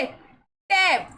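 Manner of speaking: टैप बहुत धीरे खींचकर, छोटा 'ऐ' वाला उच्चारण; टैप को धीरे-धीरे खींचकर बोलें, ई के बिना वाला गलत उच्चारण दिखाते हुए; slow drawn-out wrong reading. Shown as a demonstration. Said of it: "ई लगा दोगे तो क्या पढ़ेंगे?". (टैप धीमे, खींचे हुए स्वर में, गलत उच्चारण का नमूना)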